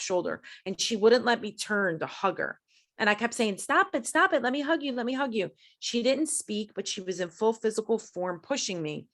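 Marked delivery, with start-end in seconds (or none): 3.6-5.5 high-pitched retold plea, easing down; insistent pleading. Woman trying to hug her cousin, insistent pleading.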